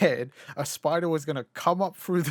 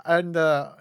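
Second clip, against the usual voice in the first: normal talking voice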